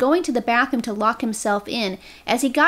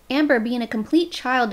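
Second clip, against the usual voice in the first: normal talking voice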